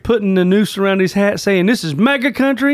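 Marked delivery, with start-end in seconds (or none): 1.7-2.7 mock tough guy. Mock quote of attackers.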